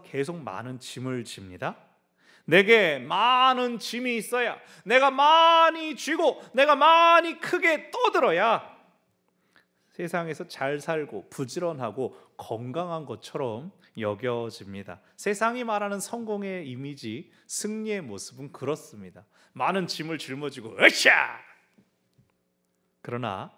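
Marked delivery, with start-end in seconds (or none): 2.5-8.8 high, loud and slow, puffed-up shout; mock boastful shouting; loud mock bluster. Mocking the world's loud success. 20.8-21.1 a loud effort grunt of lifting; heave-ho effort cry. Acted. Lifting heavy load.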